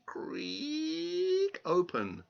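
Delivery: very high, slow creak voice; creaking door. A narrator's reading. Narrator mimics the door creaking open.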